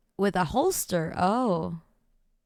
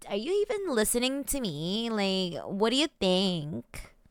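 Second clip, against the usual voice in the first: put-on Valley Girl uptalk; 3 semitones lower, 5 dB quieter than usual. Shown as a demonstration. Streamer showing how the voice works, Valley Girl uptalk. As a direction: turn it into a lilting Valley Girl question.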